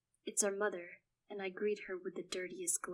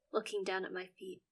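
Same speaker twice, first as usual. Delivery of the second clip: plain reading voice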